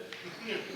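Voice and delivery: a quiet little laugh at his own joke; soft breathy chuckle. Acted. Amused by his own pun, soft chuckle. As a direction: chuckle under your breath.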